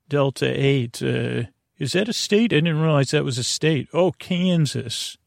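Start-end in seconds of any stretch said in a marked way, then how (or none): none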